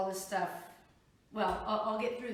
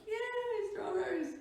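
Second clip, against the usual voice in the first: excited; 14 semitones higher, slower than usual. Real, not acted. Cheering over strawberries.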